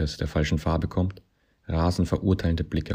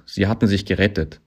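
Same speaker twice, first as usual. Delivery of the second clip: plain reading voice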